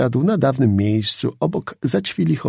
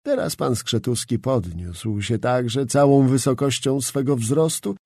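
usual speaking voice

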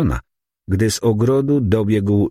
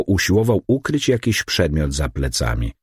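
ordinary delivery, nothing marked

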